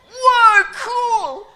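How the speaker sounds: high, loud mock wow; hammed-up American amazement; amazed American whoa. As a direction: gush like an awestruck tourist. Tourists amazed.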